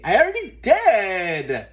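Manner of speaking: put-on very high bright girl voice; a happy schoolgirl; cheerful. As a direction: tell her gleefully. Muffy confessed.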